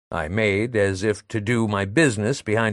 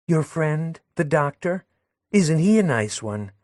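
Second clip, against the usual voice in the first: put-on lightly higher insinuating young woman; Madelon, sly; 6 semitones higher than usual. Madelon hinting to Léon about the doctor, higher sly voice. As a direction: drop the hint sweetly.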